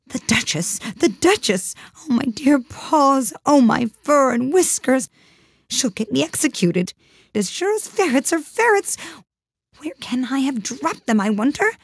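high-pitched, fretful Rabbit; anxious muttering. A narrator's reading. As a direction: fret to yourself in a high, panicky voice. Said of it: "she heard it muttering to itself".

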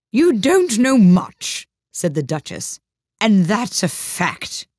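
loud, high, very slow put-down; disagreeable Duchess. A narrator's reading. Duchess puts Alice down, disagreeable and drawn-out. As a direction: draw it out loudly to put her down. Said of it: "did not at all like the tone".

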